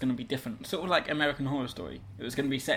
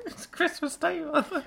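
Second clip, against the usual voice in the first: put-on high child voice; 14 semitones higher, slower than usual.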